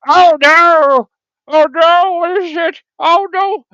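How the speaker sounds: loud, high cries of alarm; hammed-up terror from a "trained actor"; acted-out panic. Actor playing a fireworks victim, mock panic. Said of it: "I'll, I'll act it out".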